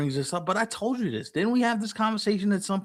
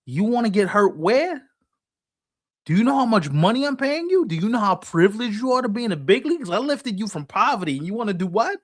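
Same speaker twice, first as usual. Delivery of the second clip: incredulous, raised-pitch boss scolding a player; indignant team owner; 4 semitones higher than usual. Acted. Team owner to Latin players, indignant. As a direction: act out an owner who can't believe the request. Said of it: "These owners are looking at these Latin players going".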